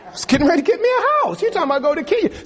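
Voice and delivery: high, fast, laughing disbelief; incredulous believer protesting God's call, laughing. Pretend. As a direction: squeal it out through a laugh.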